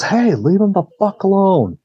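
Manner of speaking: high-pitched retold shout, not full volume; retold angry protest. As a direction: imitate a friend calling out in protest. Friend's protest to gunman, acted.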